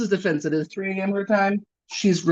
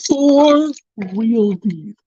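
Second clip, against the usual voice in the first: slow mock emphasis; much slower than usual.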